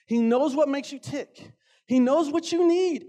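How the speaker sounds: ordinary talk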